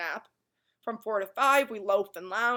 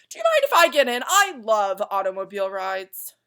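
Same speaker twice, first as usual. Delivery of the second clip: eager excited; 10 dB louder than usual. Acted. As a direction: ask it with bright eagerness. Watchdog asks eagerly.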